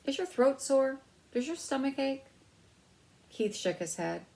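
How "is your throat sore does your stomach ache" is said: higher, louder anxious question; a lightly voiced worried mother. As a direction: worry over a sick son. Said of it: "asked anxiously".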